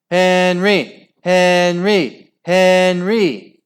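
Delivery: high, loud chanted cheer; team cheer. A narrator's reading. Team celebrating its scorer, cheering. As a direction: chant the name in even, loud bursts. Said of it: "cheered his team".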